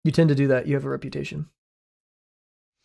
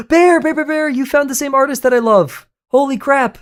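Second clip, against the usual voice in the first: sudden excited shriek; startling boo; 12 semitones higher, 8 dB louder than usual. Real, not acted. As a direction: burst out high and loud. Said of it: "Did I scare you?".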